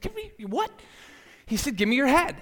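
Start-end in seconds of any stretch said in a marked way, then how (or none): 0.0-0.7 very high, baffled echo question; mock-bewildered high-pitched query; incredulous question. Baffled reply to odd request. 1.8-2.4 higher, louder repeated demand; raised-pitch insistence, retold; insistent repeat. Repeats request after baffled question.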